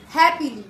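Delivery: Happily with a loud, punched हैप; strong first beat in happily; stress on हैप. Shown as a demonstration. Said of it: "तो हैप starting stressed".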